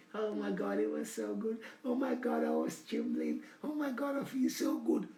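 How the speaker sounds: high, soft, breathy oh my God; soft high rapturous sighing voice; breathless swooning woman. Acted. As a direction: swoon through it as if still trembling. Woman recalling pleasure, acted.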